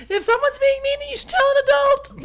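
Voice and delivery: put-on high, whiny child voice; little kid voice. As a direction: talk like an upset little fan. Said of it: "that's what I would say".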